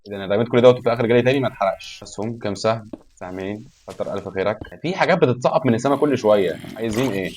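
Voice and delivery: ordinary talk, nothing marked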